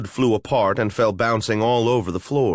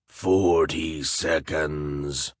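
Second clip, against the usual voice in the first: lower, very slow monster voice; wet monster voice; 6 semitones lower, 3 dB quieter, much slower than usual. Acted. A flesh golem setting a riddle for intruders, slow and lower. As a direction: drag each word out like a lumbering golem.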